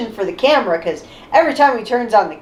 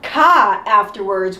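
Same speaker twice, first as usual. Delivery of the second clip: ordinary delivery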